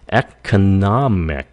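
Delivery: slow economic, ec-o-NOM-ic; third-syllable stress. Shown as a demonstration. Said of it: "Ở nhấn âm này".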